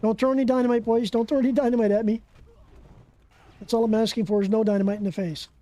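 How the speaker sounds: normal talking voice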